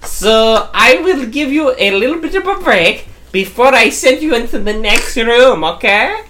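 hint of a German accent over the intercom. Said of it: "I think this is German".

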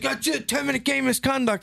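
very high, final imitated ref call; mock referee ruling. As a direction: mimic the ref ending it. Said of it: "And he's like".

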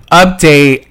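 loud laugh into a shouted word. Real, not acted. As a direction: burst out laughing, then announce it. Laughs at own single status.

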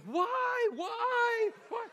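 high, loud, panicked cries of why; terrified wail. Retold or imitated. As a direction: wail it like a girl pounding the ground in terror.

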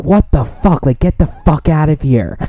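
high, loud angry yell. Retold or imitated. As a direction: throw him out with a yell. Roommate yelling at him.

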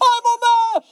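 very high hammed-up wail; mock crying. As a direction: wail it like a comic breakdown. Acts out crying breakdown.

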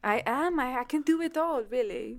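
lilting, badly faked French accent; terrible French accent. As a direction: boast in a bad French accent. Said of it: "My terrible French accent".

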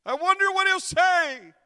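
high, full-force preaching cry; loud, soaring pulpit shout; preacher's shout. Real, not acted. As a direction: throw the question out at the top of your voice. Preacher cries out rhetorical question.

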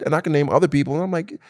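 ordinary talk, nothing marked

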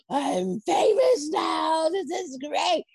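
high, slow gleeful shout of fame; over-the-top delighted yell; mock excited shout. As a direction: ham up the thrill of being famous. Retelling excited reaction to fame.

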